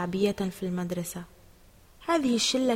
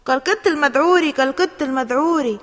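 higher, louder mocking chant; jeering; 4 semitones higher, 8 dB louder than usual. A narrator's reading. Ahmad echoing the insult at Ali, jeering. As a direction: repeat it as a loud taunt. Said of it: "ردد أحمد وهو يقهقه".

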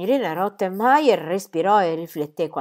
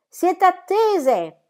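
high, slow shriek down the stairwell; shrieking from upstairs; 12 semitones higher, 5 dB louder, much slower than usual. Acted. Maid shrieks down the stairs to the visitors, high and drawn out. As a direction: stretch it out as a shrill call from above. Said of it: "e strillare da sopra".

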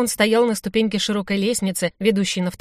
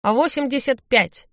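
slow, raised lottery caller's voice; drawn-out host's announcement; number announcement; 5 semitones higher, slower than usual. A narrator's reading. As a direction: call out the drawn number deliberately.

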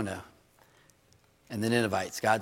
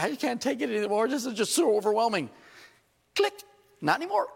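higher, dramatic mock despair, then a snap of relief; mock overwhelmed user; 10 semitones higher than usual. Preacher mimicking an overwhelmed social media user blocking someone, hammed up. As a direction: ham up the melodrama. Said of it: "It's like".

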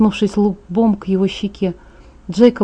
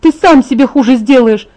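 louder, higher alarmed scold; urgent scolding; 5 semitones higher, 6 dB louder than usual. Acted. Bella scolds injured Jacob to stay in bed, urgently. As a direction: order him back down, frightened for him.

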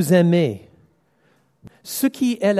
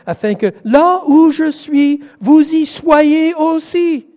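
much higher and louder, slowing on the end; climactic proclamation; 11 semitones higher, 8 dB louder than usual. Acted. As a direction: proclaim the promise with rising force. Scripture promise read climactically. (proclamation forte et aiguë, ralentie à la fin)

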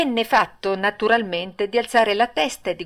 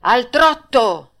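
louder, slow drawn-out order; shouted command; 4 semitones higher, 6 dB louder, slower than usual. Acted. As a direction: bark it across the ring. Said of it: "il direttore gridò".

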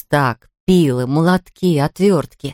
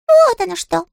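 very high, tiny sulky voice; a small touchy critter, piping high; squeaky little creature; 4 dB louder than usual. Acted. Щепкин's character voice.

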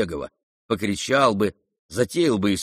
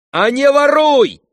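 high, loud, drawn-out furious yell; choking with anger; 10 dB louder than usual. A narrator's reading. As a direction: shout it, nearly choking with rage. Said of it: "крикнул Ганя, чуть не захлёбываясь от злости". (крик, захлёбываясь от злости)

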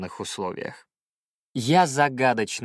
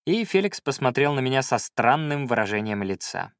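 ordinary delivery

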